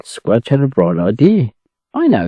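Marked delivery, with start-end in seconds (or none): 1.9-2.3 high eager exclaim. A narrator's reading. Squudge bursting out with a bright idea, high. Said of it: "he exclaimed".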